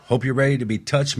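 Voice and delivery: usual speaking voice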